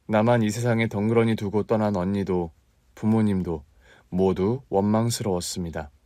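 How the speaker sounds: plain reading voice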